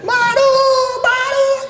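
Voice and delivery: high calling yell across the jungle; shouting a name. Retold or imitated. Lost in the jungle, calling for his colleague Maru: high shout. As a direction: call out the name loud and high. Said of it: "Que empiezo a gritar".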